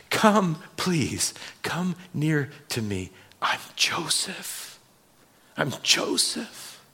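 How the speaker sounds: hushed, broken whisper of a weeping brother; tearful whisper. Acted. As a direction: whisper it through tears. Joseph revealing himself, weeping.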